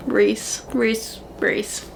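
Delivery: usual speaking voice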